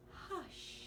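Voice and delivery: a gentle, slightly hushed hush; mild hush. A narrator's reading. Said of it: "the old lady whispering".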